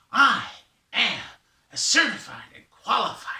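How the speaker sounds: grand, loud Raven voice; Raven introducing himself. Acted. Raven boasts to his long lost brother, grand and loud. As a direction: announce yourself proudly in punchy bursts.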